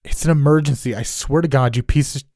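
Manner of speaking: loud, high exasperated plea; frustrated plea. Acted. Neighbor pleading with the police dispatcher, exasperated. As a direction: lose patience with the dispatcher. Stranger pleading, cursing on phone.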